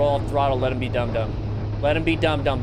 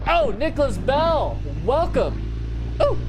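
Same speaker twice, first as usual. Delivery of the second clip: high, drawn-out cheer of greeting; big, swooping welcome shout; excited welcome; 9 semitones higher than usual. Real, not acted. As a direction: hail the newcomer with swooping high pitch. Greets a new member joining.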